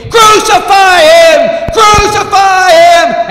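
full-force high, drawn-out shout; long, roaring mob cry; crowd crying out. Acted. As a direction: yell it like a mob demanding death. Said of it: "the people cry out".

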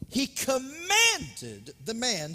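slow right down and punch "commanded"; emphasized commanded. Shown as a demonstration. Preacher re-reading the verse for emphasis, "commanded" long and forceful. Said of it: "I want to emphasize the word commanded".

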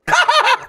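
high burst of laughter at the frog. Real, not acted. Viewer laughing at the clumsy frog, high burst. Reacting to awkward animated frog.

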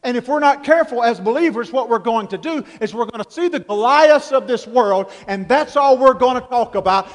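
ordinary talk, nothing marked

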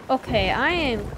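normal talking voice

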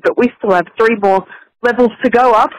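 ordinary talk

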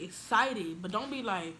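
normal talking voice

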